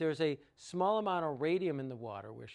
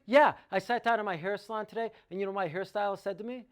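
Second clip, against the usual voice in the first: loud, higher, worked-up quote; imitating almost-angry caller; 4 semitones higher, 6 dB louder, faster than usual. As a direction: fire it off as a mock-irate customer. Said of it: "She sounds almost angry".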